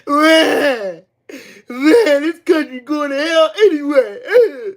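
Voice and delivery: high, drawn-out fake bawling; mock whining loser. Streamer mimicking losers who can't take a L, mock bawling. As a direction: wail it in mock defeat. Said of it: "they can't take a L for shit".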